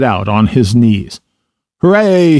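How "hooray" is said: high, delighted cheer; storybook cry of joy; excited cry. A narrator's reading. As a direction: cheer it out at a higher pitch. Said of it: "he cried, jumping up".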